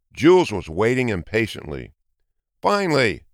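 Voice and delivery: ordinary delivery, nothing marked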